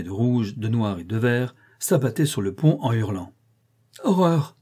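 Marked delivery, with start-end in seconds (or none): 4.0-4.6 a high, horrified cry; cry of horror. Acted. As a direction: recoil and cry out in terror. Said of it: "s'écria M". (cri d'horreur, très aigu)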